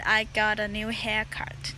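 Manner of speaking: ordinary talk, nothing marked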